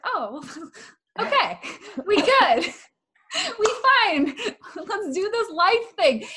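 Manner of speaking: high, loud, laughing mock pep-up; giddy relieved self-quote. Speaker acts out feeling great after crying, giddy and loud.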